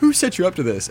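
mock indignant. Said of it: "And you're like".

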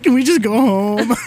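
high, loud pleading whine. Retold or imitated. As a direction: beg to go home like a scared kid. Friend pleading to go home.

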